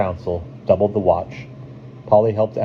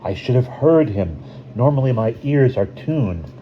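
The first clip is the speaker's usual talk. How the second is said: mild self-reproach in a higher voice; 6 semitones higher than usual. A narrator's reading. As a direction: scold yourself a little, pitch raised. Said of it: "Eagle Woman berated herself".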